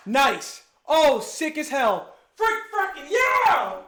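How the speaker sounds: loud, drawn-out whoops of joy; excited cheer. Real, not acted. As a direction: celebrate loudly, really pumped. Celebrating that the audio works.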